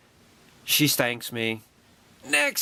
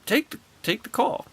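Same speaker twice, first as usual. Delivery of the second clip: higher, eager fan reply; eager to please; 11 semitones higher than usual. Retold or imitated. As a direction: hurry to agree with the star. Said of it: "I'm like".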